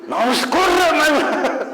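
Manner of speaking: high urgent cry of a panicking onlooker; alarmed bystander. Retold or imitated. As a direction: yell at him to run. Panicked listener at falling bridge.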